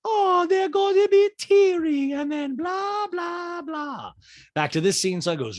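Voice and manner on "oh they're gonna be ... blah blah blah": high mocking voice, starting loud; Diana. Diana dismissing Sutton's tears, high mock impression. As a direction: stretch it out high, then tail off in blahs. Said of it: "And Diana's like".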